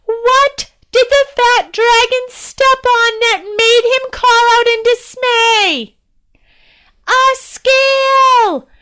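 high, loud, slow calling out; shouting to the crowd. Acted. Princess shouting jokes from the carriage, loud and high. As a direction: call it across the street to the townspeople.

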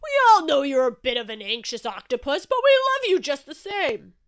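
big loud affectionate bellow; roaring. A narrator's reading. Shark telling anxious octopus he is loved, roaring. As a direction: boom it out, climbing high. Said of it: "roared Shark".

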